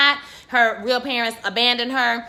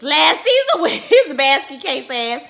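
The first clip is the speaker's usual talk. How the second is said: laughing scorn; 5 semitones higher, 6 dB louder, slower than usual. Real, not acted. Laughing mockery of ex.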